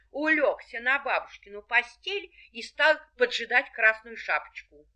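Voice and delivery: plain reading voice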